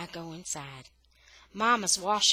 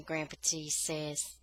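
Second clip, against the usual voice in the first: ordinary delivery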